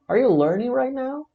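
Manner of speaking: "Are you learning right now" pitched very high, rising; interrogative intonation. Shown as a demonstration. Teacher repeating the question, "Are you learning right now" high and rising.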